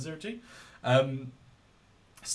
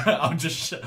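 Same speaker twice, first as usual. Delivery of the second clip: laughing; 4 semitones higher, 15 dB louder than usual. Real, not acted. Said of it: "I'll just shout all night".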